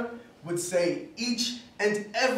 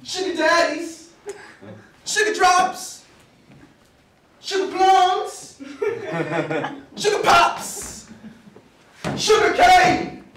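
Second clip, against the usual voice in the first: put-on high, drawn-out child's call-outs; acted kids taking turns, building louder; kids' naming game; 8 semitones higher, 4 dB louder, slower than usual. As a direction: sing out each one like a kid playing. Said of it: "we took turns naming candies".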